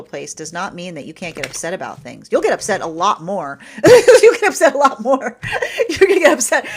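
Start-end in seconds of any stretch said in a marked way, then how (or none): 3.7-6.7 high, loud, laughing repeat. Real, not acted. Telling viewers a truth, laughing loudly. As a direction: giggle through it.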